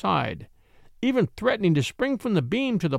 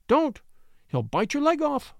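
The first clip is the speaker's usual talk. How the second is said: sharply higher alarmed warning; warning cry; 9 semitones higher than usual. Acted. Woman warning him off the dog, alarmed. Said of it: "she cried warningly".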